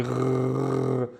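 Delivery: long rolling gargle on "grrr"; sustained back-of-throat "grrr"; gargling sound. Shown as a demonstration. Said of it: "this is the gargling sound".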